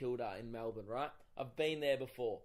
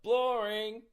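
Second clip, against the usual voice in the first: loud, higher, stretched-out jeer; mock heckle; 6 semitones higher, 6 dB louder than usual. Comedian mimicking Negative Nancy's heckle, drawn-out jeer. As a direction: drag it out in mockery.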